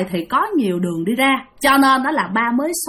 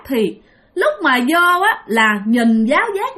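ordinary delivery

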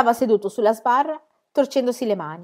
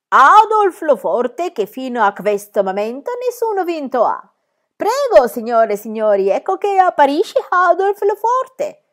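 higher, louder, slow ringmaster in broken Italian; foreign circus director; 7 semitones higher, 7 dB louder than usual. A narrator's reading. Circus director presents Adolf to the crowd, grand and foreign. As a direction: sell the strongman in a grand foreign showman voice.

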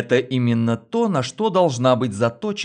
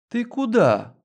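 higher, lightly voiced sleepy woman; languid coo; 10 semitones higher than usual. A narrator's reading. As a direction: coo it lazily from bed. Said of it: "томно проворковала Лена".

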